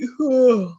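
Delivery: put-on drowsy child's sigh; heavy-eyed tired sigh; sleepy sigh. A narrator's reading. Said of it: "eyelids were heavy".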